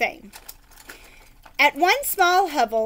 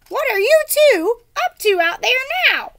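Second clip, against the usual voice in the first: much higher, louder yell; mother shouts from inside the hut, higher and loud; shouted from inside; 12 semitones higher, 8 dB louder than usual. Acted. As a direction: yell it out the door. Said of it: "shouted a voice inside the hut".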